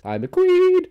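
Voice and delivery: very high, loud diva squeal; hammed-up high diva. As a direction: shriek it like a drag queen. Dog squeal.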